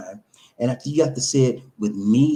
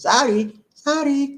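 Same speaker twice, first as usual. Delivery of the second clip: higher-pitched Filipino "sorry" with a tapped r; Filipino accent; 10 semitones higher, slower than usual. Shown as a demonstration. Showing how Filipinos say sorry, in a Filipino accent. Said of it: "That's how they say it in the Philippines".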